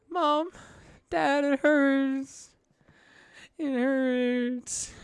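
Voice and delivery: put-on high, slow, wailing child's cry of pain; a hurt little kobold sobbing for his parents. Injured kobold child cries out for Mom and Dad in pain. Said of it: "You hear him start to cry in pain".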